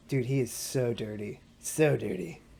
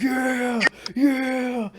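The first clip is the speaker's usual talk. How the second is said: high mock marine; 11 semitones higher, 4 dB louder than usual. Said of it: "Just like".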